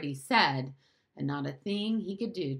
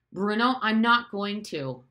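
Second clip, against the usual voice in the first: loud, raised-pitch exasperation; irritable; 5 semitones higher, 8 dB louder than usual. A narrator's reading. Father heading off his son's question, irritable. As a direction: cut in loudly with irritation. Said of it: "began Father irritably".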